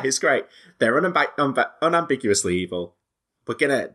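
ordinary talk, nothing marked

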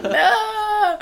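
very high, loud, drawn-out protest; hammed-up wail of refusal; mock wail. Acts out reaction to goodbye song.

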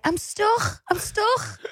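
put-on high-pitched little Scouse toddler whine; imitating toddler daughter. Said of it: "Sophia used to be in the high chair and be like".

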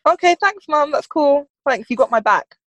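put-on casual grateful kid. Daughter's reply to the kit.

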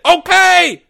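very high, loud scream; wild scream. Acted. Porge giving in to Leo, wild scream. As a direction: crack and scream it out. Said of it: "Porge screamed wildly".

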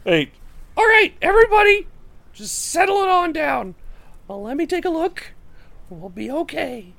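high-pitched halfling, loud at first then easing; halfling Jorik settling everyone. Acted. Halfling Jorik tells the panicked group to settle, reassuring.

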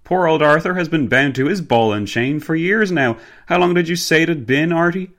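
loud, high-pitched gentlemanly banter; hearty gentleman, teasing. Acted. Gentleman teasing his married friend in the car, hearty. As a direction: tease an old friend at full voice.

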